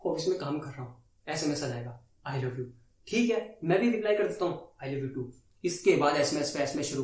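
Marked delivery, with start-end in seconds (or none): 2.3-2.7 low, soft recited endearment; mock sweet nothing. Girlfriend texts him at the office, low mock-loving murmur. Reads out girlfriend's text message. 4.9-5.2 low, soft, going through the motions; dutiful love reply. Retold or imitated. Boyfriend texts back from work, low dutiful reply.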